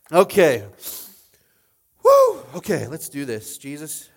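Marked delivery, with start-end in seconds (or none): 2.0-2.4 loud high whoop to shake it off; woo. Real, not acted. Pastor whooping to recover from tears, loud. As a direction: whoop to reset the mood. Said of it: "shakes off tears".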